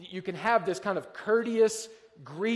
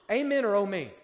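usual speaking voice

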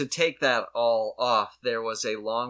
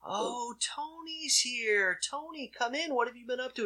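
breathy kind TV host welcome; soft Mister Rogers; 11 semitones higher, 6 dB quieter than usual. Retold or imitated. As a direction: greet him like a gentle children's host. Acting Mister Rogers greeting Tony.

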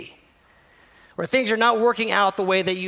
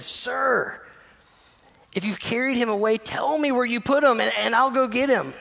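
higher, urgent plea to a stranger; pleading Mary; 6 semitones higher than usual. Acted. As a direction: beg him to tell you where.

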